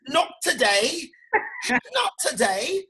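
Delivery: slow, high drawn-out British not today; mock London accent. Practicing the London accent, slow and high. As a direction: stretch it out in a put-on London voice. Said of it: "London accent".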